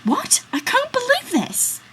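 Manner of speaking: high, indignant outburst; shocked friend's outraged cry. Acted. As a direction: burst out in disbelief and outrage. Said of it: "Friend number two is outraged".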